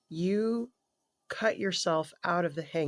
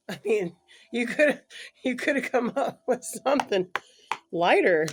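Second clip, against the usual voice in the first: higher and louder, mock exasperated; teasing exclaim; 7 semitones higher, 7 dB louder than usual. Real, not acted. Reader teasing client about harsh trial, higher louder exclaim. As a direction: throw up your hands at the heavy choice.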